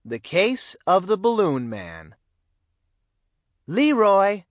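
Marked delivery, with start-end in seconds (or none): 3.7-4.4 loud call from across the house, higher. A narrator's reading. Mother calling her son to dinner, loud call. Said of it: "Missus Brown called".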